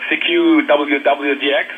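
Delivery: ordinary talk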